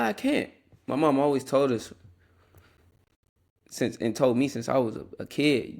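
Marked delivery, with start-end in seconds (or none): 0.0-0.5 high-pitched mock whine; imitated whine. Imitating little brother refusing one more pull-up, mock whine. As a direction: whine it high, mimicking a struggling kid.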